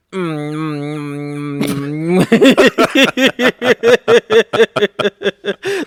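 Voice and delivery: long droning fussy hum; imitated waking baby. Dad imitating his baby waking up, whining hum. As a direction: drone like a baby stirring at night. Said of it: "he'll try to wake up".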